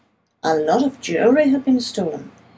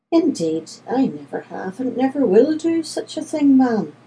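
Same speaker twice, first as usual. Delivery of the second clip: indignant denial, pitched up toward the end; protesting; 6 semitones higher than usual. A narrator's reading. Accused man answering the lady of the house, protesting. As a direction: protest your innocence, voice climbing. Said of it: "I protested".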